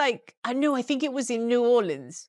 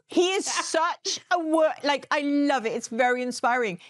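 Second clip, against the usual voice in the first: usual speaking voice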